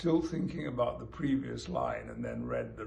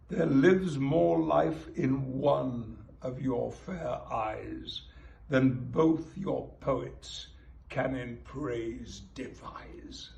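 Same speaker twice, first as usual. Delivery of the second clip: slow, measured sonnet reading; reciting a sonnet; 8 dB louder, much slower than usual. Acted. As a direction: read it aloud like a poem. Reads sonnet aloud.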